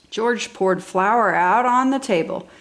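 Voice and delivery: plain reading voice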